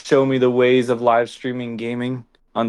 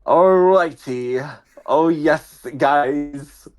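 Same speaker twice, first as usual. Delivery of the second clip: slow, higher, badly faked Russian accent; attempted Russian accent; 4 semitones higher, slower than usual. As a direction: fumble through a drawn-out Russian accent. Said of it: "first time I've ever, like, actually tried to do a Russian accent".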